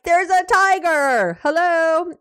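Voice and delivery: exasperated. Retold or imitated.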